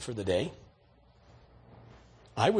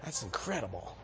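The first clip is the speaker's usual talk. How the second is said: soft, amazed, with a laugh; a quiet laughing marvel; awed hush; 7 semitones lower, 10 dB quieter than usual. Retold or imitated. Preacher watching a checkout conversion, softly amazed. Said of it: "I'm going".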